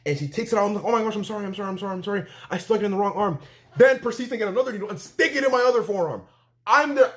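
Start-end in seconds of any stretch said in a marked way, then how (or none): 0.9-3.4 imitating a flustered nurse, higher pitch; frantic apology. Nurse apologizing to patient for wrong arm, mock-frantic. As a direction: rush out repeated apologies in a raised voice.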